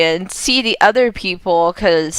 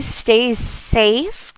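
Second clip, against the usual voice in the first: usual speaking voice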